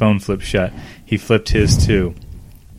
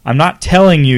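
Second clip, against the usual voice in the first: heated; 6 semitones higher, 7 dB louder than usual. Acted. Couple's heated argument.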